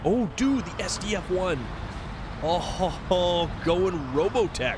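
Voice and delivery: high, delighted fan reaction; real excitement. Real, not acted. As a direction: geek out with a drawn-out "oh, ho, ho".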